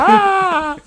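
very high put-on shriek of a friend losing it; a sudden shrill joking scream; mock scream. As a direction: screech like a madman in the car. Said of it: "Y empezó".

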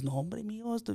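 lightly voiced grandma, soft and high; grandma's soft plea. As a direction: hush it out as a gentle abuela. Grandma quoted in Spanish.

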